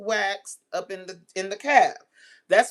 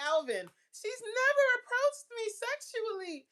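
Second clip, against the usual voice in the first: much higher, lightly voiced protest; mock naive Alvin; 4 dB quieter than usual. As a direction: protest your innocence, wide-eyed. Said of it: "you like".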